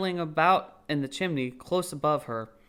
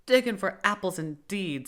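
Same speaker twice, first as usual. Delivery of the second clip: a touch of scorn, lightly raised; mild anger; 5 semitones higher than usual. Acted. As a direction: scoff at the excuse. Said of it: "said the rabbit angrily".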